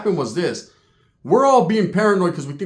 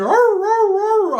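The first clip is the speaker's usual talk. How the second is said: high, loud imitated dog barking; 4 dB louder, much slower than usual. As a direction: bark like a pack of guard dogs. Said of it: "Dogs are barking".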